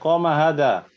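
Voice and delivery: "Qama hadza" falling at the end; statement tune. Shown as a demonstration. Student repeats teacher's falling "Qama hadza". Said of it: "Ya, itu".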